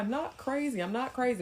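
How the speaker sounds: high pleading repeat; mock whiny denial. The man to her on the couch, high whiny denial. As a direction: whine it like a cornered kid. Voicing him whining.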